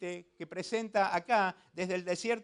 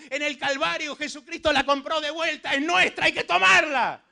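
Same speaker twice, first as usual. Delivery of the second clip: loud, forceful pulpit declaration; full-force preaching; 5 semitones higher, 8 dB louder than usual. Real, not acted. Preacher urges believers to claim the land, full-force preaching. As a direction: drive each phrase home loudly to the crowd.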